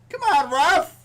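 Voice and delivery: loud, high cry at the ref; pleading with the referee. Real, not acted. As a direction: yell up at the referee in protest. Complaining about the penalty call.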